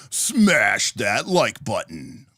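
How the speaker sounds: drawn-out, mock-bombastic appeal; hammed-up plea. Streamer asking viewers for likes, hammed-up plea. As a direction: stretch it out like an over-the-top promo.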